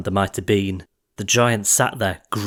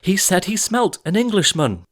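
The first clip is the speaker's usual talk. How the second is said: put-on frightened insisting boy; 10 semitones higher than usual. A narrator's reading.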